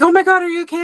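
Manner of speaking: retold cry of alarm. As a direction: imitate a startled, concerned client. Client's alarmed reaction, retold.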